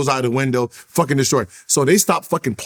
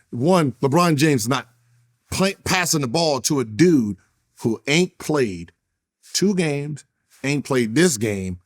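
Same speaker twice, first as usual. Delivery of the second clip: ordinary talk, nothing marked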